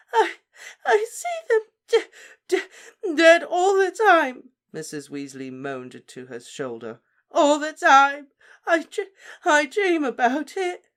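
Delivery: stammering, very high, loud weeping; words breaking into sobs; moaning through tears. Acted. Mother weeps into Lupin's shoulder, high, loud and broken.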